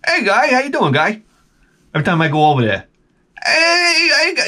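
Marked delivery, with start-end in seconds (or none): 0.1-1.2 high-pitched mock older local; Cliff Clavin-like impression. Buddy's father greets a visitor, high Cliff Clavin-like voice. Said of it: "it was like a combination of Cliff Clavin". 3.4-4.4 high-pitched mock older local; Cliff Clavin-like impression. Buddy's father greets a visitor, high Cliff Clavin-like voice. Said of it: "it was like a combination of Cliff Clavin".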